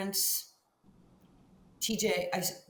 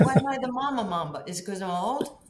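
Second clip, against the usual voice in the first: mock indignant; 3 semitones higher, 6 dB louder than usual. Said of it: "I said".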